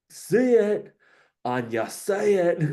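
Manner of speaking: high, far-off mock Conor voice, laughing; hammed-up fighter hype voice; quoting Conor McGregor. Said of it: "and he was just like".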